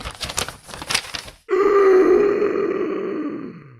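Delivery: long high gasp of amazement; theatrical gasp. Acted. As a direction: gasp as if shocked. Reading the letter in shock.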